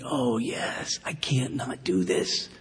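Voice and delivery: an unvoiced hush of discovery; whisper. Preacher whispering what studying the Word taught. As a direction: breathe it like a secret you just found.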